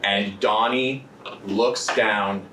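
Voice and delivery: slow, hushed suspense narration; dramatic narration. Acted. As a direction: draw out each beat for tension. GM narrates tense scene.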